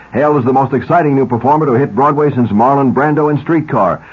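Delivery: ordinary talk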